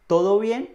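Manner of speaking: "¿Todo bien?" rising high at the end; questioning tune. Shown as a demonstration. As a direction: ask "¿Todo bien?" loudly, with the pitch climbing. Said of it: "se usa más como pregunta". ("¿Todo bien?" como pregunta, subiendo el tono)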